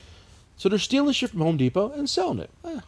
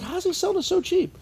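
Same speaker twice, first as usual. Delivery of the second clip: high, baffled disbelief; how can he sell it that cheap; incredulous; 12 semitones higher than usual. Retold or imitated. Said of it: "And I'm like".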